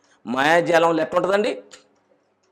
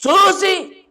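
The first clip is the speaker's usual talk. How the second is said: high, loud pulpit proclamation; raised, ringing sermon voice; full-force preaching; 11 semitones higher, 5 dB louder than usual. Acted. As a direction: preach it out to the congregation.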